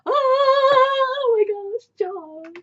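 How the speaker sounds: long, high, loud wail; exasperated outburst. Acted. Reader erupting at the characters, exasperated wail. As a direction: stretch it into a high-pitched cry.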